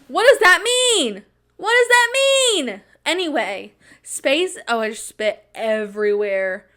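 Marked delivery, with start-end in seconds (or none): 0.1-2.8 high, loud, slow outraged question; shrieking disbelief. Real, not acted.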